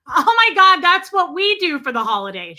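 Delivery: high, shouted mock gushing; imitating loud phone talker. Customer exclaiming into her speakerphone behind someone, mock shouting. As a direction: blare it into the phone. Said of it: "loud behind me".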